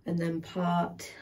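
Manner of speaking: usual speaking voice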